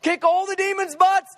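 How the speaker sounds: high, fast, pumped-up rush; excited Father. Acted. Father rallies the Son for victory, fast excited hype. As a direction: hype it up like a locker-room pep talk. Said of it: "the Father".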